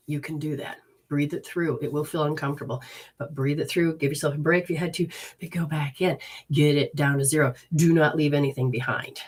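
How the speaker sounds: calm them through it gently; soothing guidance. Acted.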